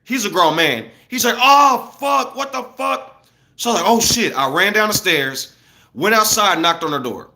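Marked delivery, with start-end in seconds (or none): 1.4-3.2 high, panicked yell of a startled man; mock scream. Grown man screaming after boom. 3.9-4.4 alarmed. Retold or imitated. Reacting to neighbour's scream.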